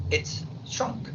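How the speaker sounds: "It's shrunk" in a soft whisper; whispered answer. Shown as a demonstration. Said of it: "¿Cómo se dice entonces". ("It's shrunk" susurrado)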